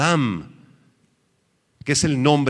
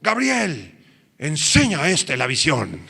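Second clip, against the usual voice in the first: commanding cry; 3 semitones higher than usual. Acted. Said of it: "¿Y qué gritó esta voz?".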